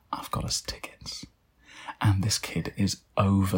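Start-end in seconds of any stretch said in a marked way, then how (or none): 0.1-1.3 a hushed, secretive whisper. Acted. Mum whispering the surprise news to her son, hushed. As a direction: breathe it like a delicious secret.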